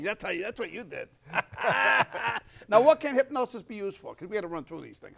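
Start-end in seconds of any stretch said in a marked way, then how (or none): 1.1-2.6 loud, amused laugh; big laugh, pitched up; real laugh. Real, not acted. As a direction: burst out laughing at your own joke. Laughing at hypnotized paying joke.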